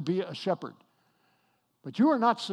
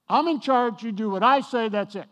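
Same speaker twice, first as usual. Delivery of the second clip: mock domineering leader, louder and higher; bossy church leader; 4 semitones higher, 6 dB louder than usual. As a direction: throw your weight around like a petty church boss. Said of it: "so misused by church leaders".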